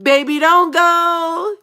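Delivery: high, whiny begging plea; pleading. Retold or imitated. Begging her man to stay, high pleading. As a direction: beg him not to go, hammed up. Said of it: "I'm like".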